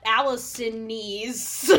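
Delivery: Allisonese said slowly as Allison-EEZ; mocked possessive ending. Shown as a demonstration. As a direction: stretch the EEZ on the end of Allisonese. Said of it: "I don't call it Allisonese".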